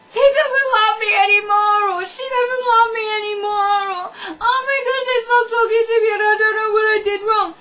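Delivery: high, loud, tearful hammed-up wailing; mock heartbroken wail. Dumped teenager after they stopped talking, mock wailing. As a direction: bawl as if the world is ending. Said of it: "the whole world's going to end".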